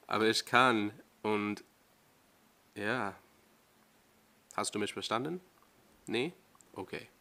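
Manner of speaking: halting German, slightly changed voice; tentative German accent; careful German pronunciation. Real, not acted. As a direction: try to sound German, pausing between thoughts. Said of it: "my voice changes when I speak German".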